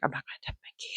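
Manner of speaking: hushed, breath-only refusal; whispered aside. Retold or imitated. Mother telling the boys she won't try Mickey, whispered. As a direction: whisper it, barely voiced.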